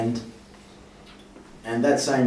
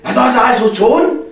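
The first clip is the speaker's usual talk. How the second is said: mock German accent, higher and louder; imitating the German bassist yelling at the bass tech; 10 semitones higher, 8 dB louder than usual. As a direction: bark it like an angry German bassist. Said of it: "yells at me with his German accent".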